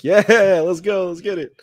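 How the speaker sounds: high-pitched hype, opening loud; hyped cheer. Retold or imitated. Host cheering his rider's new nickname, retold excited hype.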